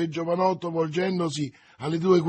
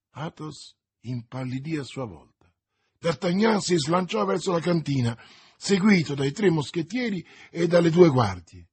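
ordinary delivery, nothing marked

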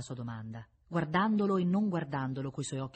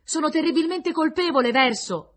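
high, very loud cry; raised voice held high to carry; shouted plea; 12 semitones higher, 12 dB louder than usual. A narrator's reading. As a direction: beg forgiveness at the top of your voice. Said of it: "gridò ancora".

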